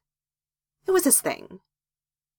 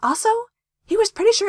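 eager, higher-pitched explaining; lightly acted high enthusiasm; excited; 5 dB louder than usual. A narrator's reading. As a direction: rush to add it with excitement. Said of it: "Naruto said excitedly".

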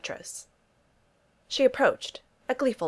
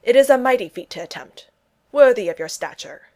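louder, booming like a proud god; grand hearty boast; 5 semitones higher, 9 dB louder than usual. Acted. Thor praising Steve's bold challenge, booming and grand. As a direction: declare it like a heroic feat.

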